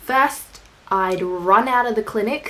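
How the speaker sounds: ordinary delivery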